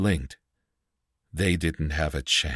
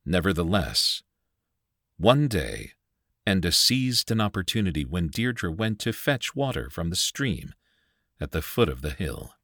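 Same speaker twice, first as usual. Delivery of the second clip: ordinary delivery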